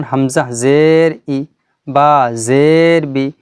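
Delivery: measured spelling recitation with ই and বি cut short; short final vowel. Shown as a demonstration. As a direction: hold জের, then end ই and বি at once. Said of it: "শব্দকে শেষ করে দিতে".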